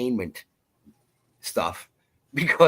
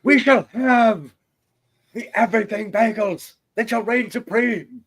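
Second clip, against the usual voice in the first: mock grand proclamation; 12 semitones higher, 4 dB louder than usual. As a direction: proclaim supremacy like a pompous chief.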